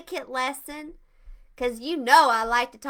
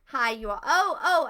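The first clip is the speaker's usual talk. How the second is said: ordinary delivery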